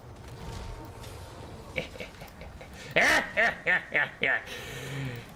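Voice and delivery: cackling at the enemy's misfortune; a long gloating cackle; gleeful villain laugh. Real, not acted. Gamer cackles as the enemy gets hit.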